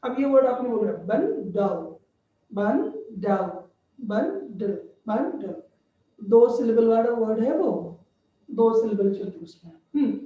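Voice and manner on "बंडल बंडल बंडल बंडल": "बंडल" broken into two beats, ban-dal; "बंडल" in two separate, measured syllables, softly repeated; split into syllables. Shown as a demonstration. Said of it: "syllable की तरफ चलो".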